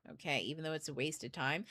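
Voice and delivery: low, soft aside; subdued, measured reply; calm inner voice. Acted. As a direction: reason quietly with your angry self. Calmer inner voice answering the angry one.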